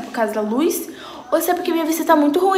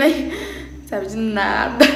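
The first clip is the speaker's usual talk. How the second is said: ordinary delivery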